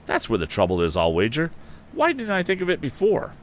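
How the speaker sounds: sudden realization, high and eager; excited cry. A narrator's reading. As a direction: it dawns on him, burst out. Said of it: "Then Tom cried".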